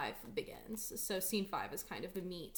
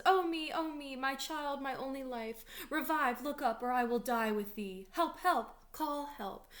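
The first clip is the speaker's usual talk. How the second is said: high, raised theatrical lament; acted anguished cries; 5 semitones higher, 5 dB louder than usual. Lady Capulet over her only child, acted anguished cries for help. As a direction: cry out as a distraught mother.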